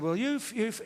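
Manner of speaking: stammer, snagging on one word. Acted. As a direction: get stuck on "you've" and repeat it. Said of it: "Freddie stammered a bit".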